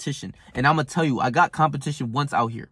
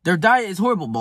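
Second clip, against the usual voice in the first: ordinary delivery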